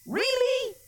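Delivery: very high-pitched disbelief; incredulous. Acted. Preacher scoffs at the Assyrians' claim, incredulous.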